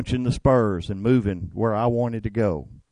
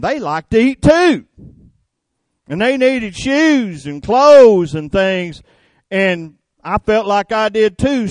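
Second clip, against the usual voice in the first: louder, higher, slowing down on the last words; building preaching; 6 semitones higher, 6 dB louder than usual. Real, not acted. Preacher tells congregation why he worked, fervent and louder. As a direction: preach it, pressing each point.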